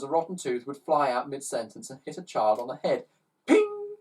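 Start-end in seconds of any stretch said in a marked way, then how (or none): 3.5-4.0 high squeaky ping; cartoon ping; ping sound effect. A narrator's reading. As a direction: pop the word out like a flying tooth.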